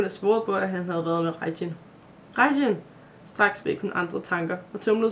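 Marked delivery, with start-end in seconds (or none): 2.4-2.8 higher and louder, calling his name; anxious call. A narrator's reading. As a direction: cry out for the boy in fear. Maja calls to the unconscious boy. (Maja råber på den bevidstløse dreng)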